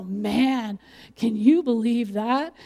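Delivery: slow, sneering mock disbelief; mock the brave one behind their back; drawn-out scoffing at someone's courage; scornful gossip. Imagined gossip after church.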